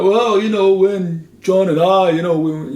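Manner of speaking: loud, lightly put-on Liverpool accent, slowed. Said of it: "I can't do a [name] impression".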